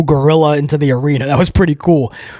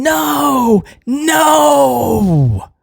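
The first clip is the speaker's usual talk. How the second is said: high, pained cry of no; retold anguished scream; 8 semitones higher than usual. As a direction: wail it like a man watching his friend fall. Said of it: "Paul Heyman screaming".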